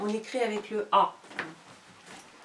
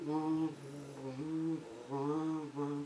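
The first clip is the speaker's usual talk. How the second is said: purring cat; 6 semitones lower, 11 dB quieter, much slower than usual. Shown as a demonstration. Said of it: "il fait ron".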